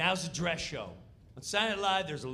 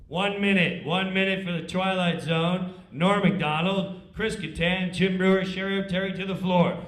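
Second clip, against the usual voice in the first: stage manager call; 9 dB louder than usual. Retold or imitated. Stage manager calling cast.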